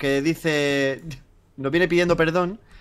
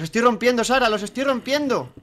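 higher-pitched, insistent protest; mock-earnest insistence; 6 semitones higher than usual. Real, not acted. Player telling Sara they are breaking it, mock-earnest. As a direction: insist as if it were really true. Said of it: "Lo dice con una voz así como de que si fuera verdad".

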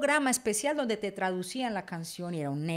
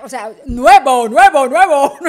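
loud, laughing mock announcer shout; hammed-up DJ yelling nuevo; radio jingle voice; 2 semitones higher, 17 dB louder, slower than usual. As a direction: blare the station tag again, cracking up. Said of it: "metía la cuchareta el locutor".

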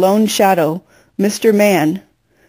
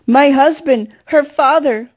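higher, lightly voiced grief-stricken wail; 6 semitones higher than usual. A narrator's reading. Mama cries out for her husband, a high mild wail. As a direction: wail it out, pitch climbing. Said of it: "Mama wailed".

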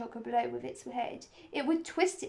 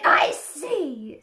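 sharp, loud cut-in; loud interruption; 3 semitones higher, 10 dB louder than usual. A narrator's reading. Queen cuts off the gardener's excuse, loud. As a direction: cut them off at full volume.

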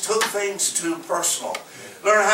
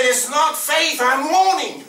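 higher, slow, hammed-up sarcastic comeback; sarcastic retort; 9 semitones higher, 4 dB louder, slower than usual. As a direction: toss it back with a mock-whiny punch. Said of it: "I said".

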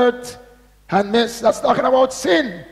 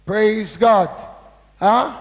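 ordinary talk

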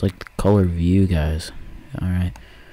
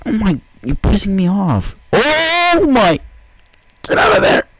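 high, louder squeals of annoyance; high exasperated squeal; 11 semitones higher, 3 dB louder than usual. Real, not acted. Gamer squealing at pushy wolves. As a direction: squeal it, fed up. Mobs pushing him around.

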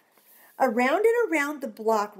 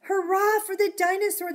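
cheering; 8 semitones higher, 5 dB louder than usual. Acted. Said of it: "the children cried".

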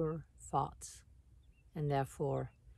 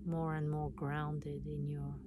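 calm meditation voice with long pauses; guided meditation; 5 dB quieter than usual. Acted. Guiding listeners to relax and notice their breath, meditation voice. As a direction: guide them inward, unhurried, leaving space between phrases.

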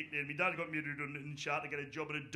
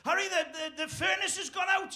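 mock panicked caller; 12 semitones higher, 4 dB louder than usual. Fitter phoning about furnace.

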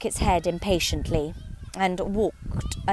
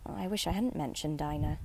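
much softer, sad murmur to herself; melancholy; 3 semitones lower, 8 dB quieter than usual. A narrator's reading. Alice alone, saying to herself in a soft melancholy tone. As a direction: speak low, as if regret is sinking in. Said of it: "in a melancholy tone".

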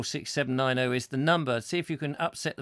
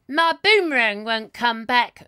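high, louder comic sing-song; 8 semitones higher, 7 dB louder than usual. Retold or imitated. Host does the old comic's song line, high and loud. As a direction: wail it like a sad little comic. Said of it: "And that's [name]".

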